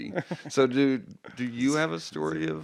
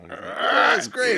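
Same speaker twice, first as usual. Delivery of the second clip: sarcastic shout; 13 semitones higher, 9 dB louder than usual. Acted. Exaggerated shout reply.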